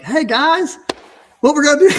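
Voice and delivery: high, bright crew greeting; mock cheery greeting. Boss jumping out of the truck, greeting the crew, cheerily high.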